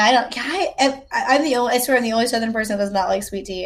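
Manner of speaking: normal talking voice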